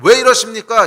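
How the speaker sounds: 높고 크게 따지는 항변; 하나님께 따지듯 크게; protesting. Acted. Peter-like protest voiced. (베드로처럼 예수님께 항변하며 따지기)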